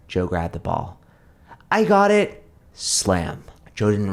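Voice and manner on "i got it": put-on high, loud kid's cry; boy shouting in triumph; excited shout. A narrator's reading. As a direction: yell it out triumphantly. Joe shouts grabbing ball.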